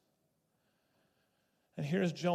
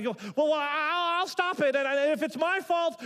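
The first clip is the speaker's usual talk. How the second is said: high, loud, stammering panic; terrified Jonah; 10 semitones higher, 5 dB louder than usual. Acted. Jonah to the sailors in the storm, frightened and stammering. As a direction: blurt it out in a frightened rush. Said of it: "Jonah's terrified".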